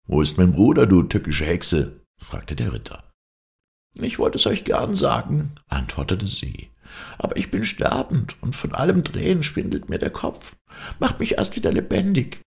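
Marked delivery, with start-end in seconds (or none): 0.1-2.0 loud, accusing knight; angry demand. Acted. Knight demands his brother from the witch, loud and accusing. 3.9-5.5 high-pitched crone voice; dying old witch. Acted. Dying witch answers the knight, high crone voice. 7.2-12.4 very high crone voice; dying old witch. Acted. Dying witch begs the knight for revival, high crone voice.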